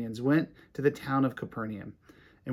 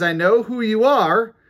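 lightly voiced demon, loud and raised at first; demon's challenge in a preacher's raised voice; demon outburst; 4 semitones higher, 6 dB louder than usual. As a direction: call it out as the demon, easing off at the end. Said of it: "The demon says".

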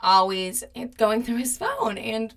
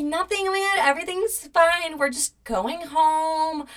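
feigned reassurance; 7 semitones higher than usual. Retold or imitated. Covering up while taking ex to car, high feigned reassurance. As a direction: imitate brushing off questions too sweetly.

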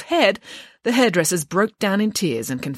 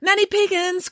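put-on high-pitched girl's call; high voice calling from across the room. A narrator's reading. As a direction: cry out for the nanny's attention. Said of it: "cried a voice".

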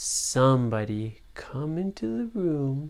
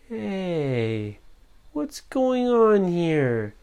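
put-on puzzled boy voice; 4 semitones higher, much slower than usual. Boy voiced in bedtime story.

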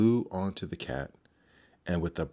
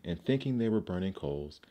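usual speaking voice

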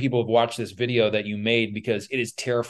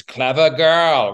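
slow, louder, savoring each word; movie quote; 4 semitones higher, 6 dB louder, much slower than usual. Retold or imitated. Quoting the Jurassic Park hunter, slow relishing line.

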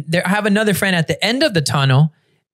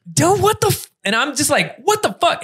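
high, stunned what the fuck; outraged surprise, voice shooting up; high disbelieving outburst at the betrayal; shocked disbelief; 9 semitones higher than usual. Retold or imitated. Shocked by friend's confession.

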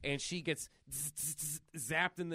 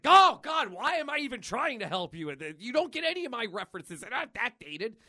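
high, much louder, fast complaining whine; exasperated Deadpool; 8 semitones higher, 8 dB louder than usual. Retold or imitated. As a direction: throw up your hands, fast. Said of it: "Deadpool's just like".

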